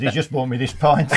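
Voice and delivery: can't keep a straight face at the end; punchline cracking into laughter; laughing through punchline. Real, not acted.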